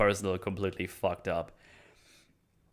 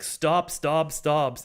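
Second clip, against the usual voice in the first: urgent, raised retold cry to stop; higher, louder, insistent repeats; adamant plea; 8 semitones higher, 5 dB louder, much slower than usual. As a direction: imitate someone loudly demanding a halt. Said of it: "he's very, uh, adamant about".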